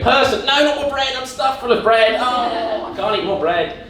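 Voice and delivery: a duck warns a person about bread, high complaining voice; duck's voice. Retold or imitated. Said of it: "They go".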